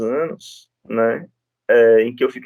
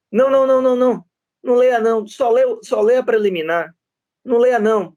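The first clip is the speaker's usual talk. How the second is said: higher, insistent mock of the opposing lawyer; imitating a pushy lawyer cutting in, higher; pushy pressuring lawyer; 9 semitones higher, faster than usual. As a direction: press him to hurry up and skip the reading. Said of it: "fez aquela pressão".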